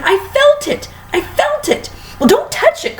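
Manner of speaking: very high squeaky panicked voice; squeaky Twit voice. A narrator's reading. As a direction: squeal it like a frantic little man. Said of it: "shouted Mister Twit".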